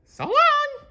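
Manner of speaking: very high cheerful falsetto call; high cartoon mouse calling goodbye; Mickey's farewell. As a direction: call out goodbye as you leap. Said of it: "he said as he jumped".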